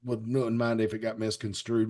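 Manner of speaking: normal talking voice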